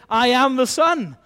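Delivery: lightly voiced Son, declaring firmly. Son announcing who sent him, loud declaration. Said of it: "You've got a Son who is saying".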